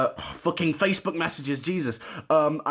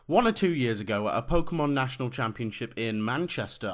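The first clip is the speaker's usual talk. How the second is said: normal talking voice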